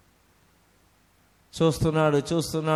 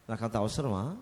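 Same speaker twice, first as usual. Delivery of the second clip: softer, lower, musing to himself; softly; 8 semitones lower, 8 dB quieter than usual. Retold or imitated. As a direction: say నాకు అంత అవసరమా quietly to yourself. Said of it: "మెల్లగా చెప్తే". (మెల్లగా, తనలో తాను అనుకున్నట్టు)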